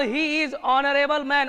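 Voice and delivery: each word read out slowly; slow dictation read. Shown as a demonstration. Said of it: "honourable". (धीरे-धीरे वाक्य पढ़ना)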